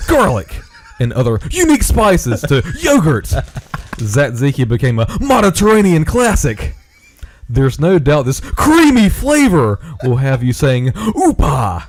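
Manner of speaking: hammed-up ad copy, key words swooping up; emphatic all-caps reading. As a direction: punch up the key words without yelling. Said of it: "in all caps".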